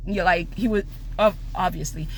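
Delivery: usual speaking voice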